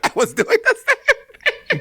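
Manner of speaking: high laugh through the words, slowed. Real, not acted. Admitting he did the same thing, laughing through it. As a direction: laugh while confessing.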